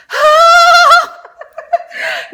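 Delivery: long, loud, high-pitched squeal; high giddy squeal of delight; smitten squeal. Retold or imitated. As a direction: squeal like you've just fallen for someone. Acts out her smitten reaction.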